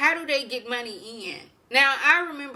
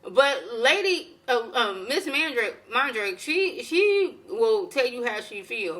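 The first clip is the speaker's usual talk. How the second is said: usual speaking voice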